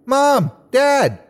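high, excited call; yell. A narrator's reading. Boy calls to his living parents, high happy yell. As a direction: call out to them, pitched far up. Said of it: "I yelled".

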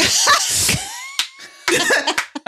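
a loud laugh that shoots very high; big shrieking laugh. Real, not acted. Mother cracks up at her daughter's teasing, shrieking laugh. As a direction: let a loud, squealing laugh burst out.

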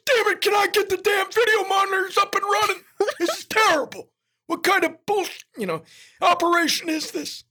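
ranting in a rough, strained shout; gruff big man bellowing about broken equipment; Alan Graff. Acted. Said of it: "And he goes".

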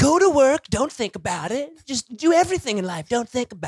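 mock carefree advice. Man parodying the show's advice, mock carefree.

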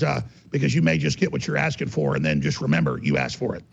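ordinary talk, nothing marked

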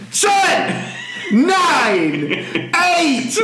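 very high-pitched, drawn-out shouted numbers; hammed-up countdown yell; mock loud countdown. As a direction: imitate an over-intense helper counting down. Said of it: "he was getting louder".

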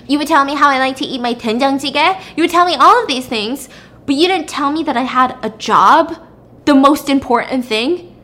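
accusing. Acted. Acted wife confronts husband over secret.

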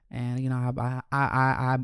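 normal talking voice

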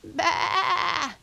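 high, loud lamb bleat; crying lambs' bleat. A narrator's reading. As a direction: bleat out like a distressed little lamb. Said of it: "cried the lambs".

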